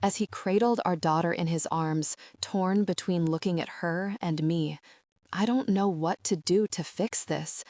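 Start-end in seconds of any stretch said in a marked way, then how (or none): none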